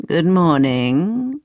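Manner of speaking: put-on slow, drawn-out old man's greeting. Old man greets the boy who lost his ball, slow old man voice. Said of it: "an old man with a long grey beard".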